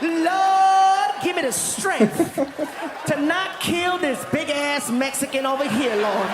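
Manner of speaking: high, loud sing-song cry to the Lord; hammed-up plea to God. Woman voiced begging God not to kill the comedian, loud wail. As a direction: beg the Lord loudly for patience, mock-anguished.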